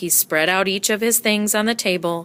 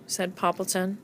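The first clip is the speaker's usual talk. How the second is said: plain reading voice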